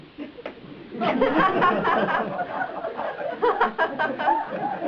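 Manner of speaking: a long high rising laugh. Real, not acted. Teacher laughing at own meat pun, high laugh. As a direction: let a big high laugh ride up.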